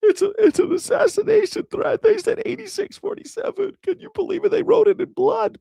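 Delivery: very high, breathless hysterical whine; mock panic. Mocking MAGA fans, hysterical high-pitched outrage. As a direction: shriek it like a melodramatic victim.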